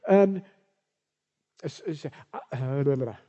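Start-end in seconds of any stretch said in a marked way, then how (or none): none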